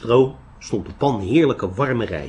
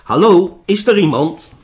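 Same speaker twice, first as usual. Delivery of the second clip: raised, louder hallo to an empty house; calling out; 8 semitones higher, 7 dB louder than usual. A narrator's reading. Siddhartha checks whether the old hut is empty, calling out. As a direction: call out to see if anyone is home. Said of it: "riep hij een paar keer".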